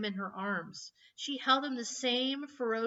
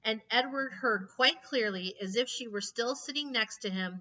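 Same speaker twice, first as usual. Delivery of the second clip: ordinary delivery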